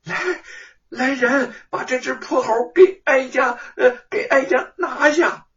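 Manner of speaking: high, loud shrill order to seize; enraged consort yelling orders; furious shout. A narrator's reading. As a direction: scream for the guards in outrage. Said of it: "又羞又怒，就喊".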